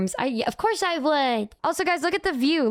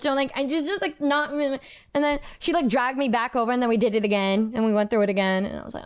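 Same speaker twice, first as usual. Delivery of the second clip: ordinary talk, nothing marked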